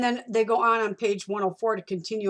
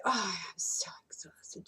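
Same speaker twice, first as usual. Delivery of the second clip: mock exhausted whisper; 8 dB quieter, slower than usual. Rude worn-out clerk mocked.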